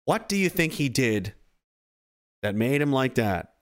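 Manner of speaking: ordinary delivery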